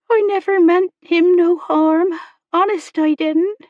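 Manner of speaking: put-on high, loud rustic girl's voice; upset servant girl, pitched well up; distressed young girl. A narrator's reading. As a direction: plead your innocence in a high girlish voice. Girl Becky protesting, face crumpled.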